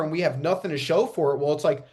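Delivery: ordinary talk, nothing marked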